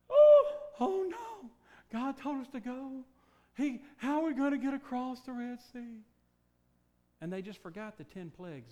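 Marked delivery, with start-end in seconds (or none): 0.1-6.0 panicked Israelites. Acted. Israelites at the Red Sea, mock panic. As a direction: cry out in hammed-up alarm. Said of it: "there's a barrier".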